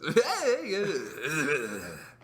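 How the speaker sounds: long gibberish noise imitating a friend's voice; exaggerated wordless caricature; drawn-out teasing babble; mocking wordless imitation. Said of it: "And you talk like this".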